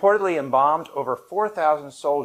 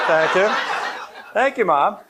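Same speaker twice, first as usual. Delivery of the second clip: laughing thanks; 6 semitones higher, 4 dB louder, much slower than usual. Real, not acted. Applause from family in audience.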